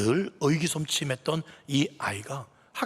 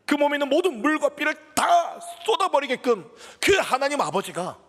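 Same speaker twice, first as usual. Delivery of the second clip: rising, louder preaching with each repeat; full-force preaching; 9 semitones higher, 4 dB louder than usual. Acted. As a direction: drive the point home from the pulpit. Sermon climbs to full force. (힘차게 외치는 설교)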